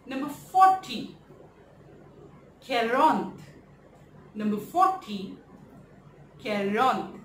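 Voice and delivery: "Caronte" said as French quarante, slow and clear; French pronunciation. Shown as a demonstration. Teacher modelling forty in French for the class. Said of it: "we call it".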